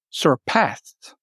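"surpassed" said alone, weight on PASSED; second-syllable stress. Shown as a demonstration. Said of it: "the accent falls on the second".

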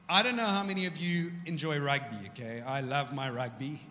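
normal talking voice

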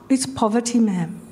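subdued, dropping low on "ma'am"; whole voice change. Retold or imitated. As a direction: imitate a caller suddenly humbled. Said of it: "this whole voice change".

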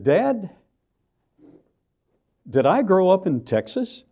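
high, mock-indignant plea; incredulous question to dad. Speaker calling out to his father in the crowd, high and incredulous. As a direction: toss it up high like you can't believe it.

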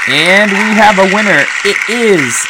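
high, loud winner reveal; hyped giveaway announcement. Acted. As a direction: belt it out like an announcer.

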